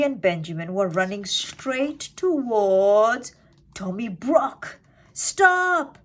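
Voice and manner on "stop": much higher, louder warning yell. A narrator's reading. Peter warning his friends with a loud yell. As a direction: shout to stop friends in danger. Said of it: "yelled Peter".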